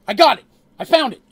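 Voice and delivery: loud, high burst of excitement; excited discovery. Acted. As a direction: exclaim it in sudden triumph. Character suddenly finds the solution.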